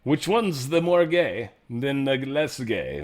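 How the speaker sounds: ordinary talk, nothing marked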